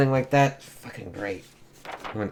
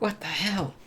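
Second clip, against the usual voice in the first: high startled different voice; 9 semitones higher, 9 dB quieter than usual. Acted. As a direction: react like someone caught off guard. Magic reacts in comic.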